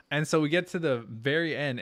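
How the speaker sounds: ordinary delivery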